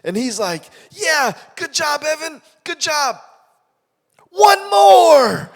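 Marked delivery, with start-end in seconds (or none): 0.9-3.2 high, upbeat praise; praising coach. Retold or imitated. 4.3-5.5 much higher, louder shout; coach's shout. Retold or imitated.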